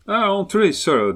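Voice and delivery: put-on an excited English gentleman warning the host, thick English accent; strong English accent. A narrator's reading. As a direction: fort accent anglais. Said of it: "avec un accent anglais fortement prononcé".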